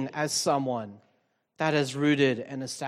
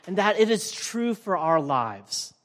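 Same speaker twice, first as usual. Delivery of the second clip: normal talking voice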